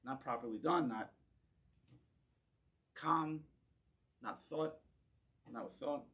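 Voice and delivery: ordinary talk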